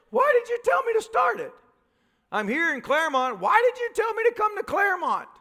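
high-pitched exasperated complaint; protesting to God. Acted. Stuck believer asking God why, in high exasperated protest.

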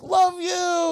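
high singsong love you; chorus of teens. Retold or imitated. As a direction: chirp it like carefree teens. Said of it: "they all were like".

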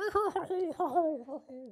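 high squeaky giggle; leprechaun cackle. Acted. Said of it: "That was my leprechaun impression".

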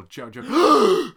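high, loud drawn-out name; dramatic shout. Acted. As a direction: bellow the name in outrage. Dramatic quote of Wamu.

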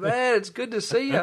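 light up as if meeting a long-lost mate; excited greeting. Shown as a demonstration. Greeting his friend warmly, a big excited hello. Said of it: "it's like".

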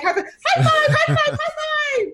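very high squealing mock children's chant; high-pitched gleeful shrieks with laughter; hysterical cheering. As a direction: imitate hysterically excited little girls. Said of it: "They went hysterical over you".

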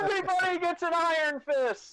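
screaming high with joy; ecstatic shout. Acted. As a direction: scream it to the studio crowd. Climax of giveaway parody.

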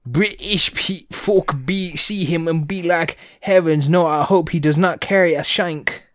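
high, hammed-up British accent; exaggerated mock British voice; bad British accent. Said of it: "what fucking accent was that".

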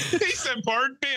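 high-pitched, cracking up while talking; real giggly retelling; laughing. Real, not acted. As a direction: struggle to retell it through laughter. Cracking up retelling own joke.

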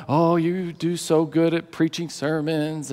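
slow, soft, drawn-out flattery; butter him up gently, stretching the words; sweet coaxing. Acted. Imagining a sweet, flattering wife.

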